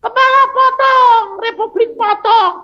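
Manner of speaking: high, loud shouted threat; armed rebels yelling at the captive preacher; threatening yell. Retold or imitated. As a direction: bark the order to cut.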